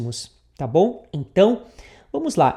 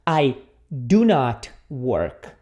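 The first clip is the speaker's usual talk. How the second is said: slow, lower-pitched, with gaps between the words; corrected model; 6 semitones lower, much slower than usual. Shown as a demonstration. Teacher correcting the wrong negative, slow and low. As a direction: set out "I do not work" slowly and deliberately. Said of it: "Não, tem que ser".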